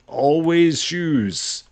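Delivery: very slow, stretched mock sigh on Always; drawn-out complaint. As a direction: drag out "Always shoes" in mock exasperation. Shoes out again, repeated.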